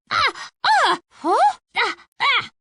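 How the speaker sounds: sharp, high gasps of pain; pained cries. Acted. Dragon hit in battle, crying out in pain. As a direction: yelp as each blow lands.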